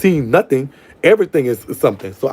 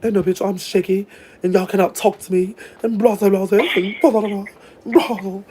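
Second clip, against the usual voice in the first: mock diva; 6 semitones higher than usual. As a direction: play the snobby star waving everyone off. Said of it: "I'll toot my ass up and say".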